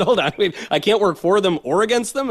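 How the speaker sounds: laughing, incredulous imitation of a nominee; imitated amused disbelief. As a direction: retell it with a laugh, speeding up at the end. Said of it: "Kennedy basically laughed".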